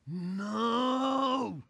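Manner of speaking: loud despairing "No!"; dismayed shout. Retold or imitated. Player missing his penalty, loud despairing shout. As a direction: shout "No!" as the shot goes wide. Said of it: "So I also go".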